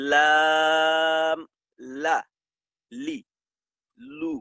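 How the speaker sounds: drawn-out লাম with the tongue tip held on the gum ridge, then short লা, লি, লু; lam sound demo. Shown as a demonstration. Teacher demonstrating লাম to Quran students, slow and drawn out. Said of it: "এই ক্ষেত্রে আপনার উচ্চারণটা এমন হবে".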